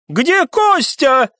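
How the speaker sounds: high, drawn-out frantic cry; hysterical. A narrator's reading. Viscount rushing at the returning lad, hysterical. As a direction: demand it hysterically. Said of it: "вдруг истеричным тоном воскликнул виконт".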